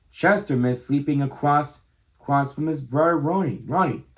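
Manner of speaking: plain reading voice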